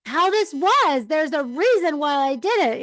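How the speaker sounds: defensive, rising pitch; exasperated pleading. Retold or imitated. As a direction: plead your case, voice jumping up. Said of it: "And I'm like".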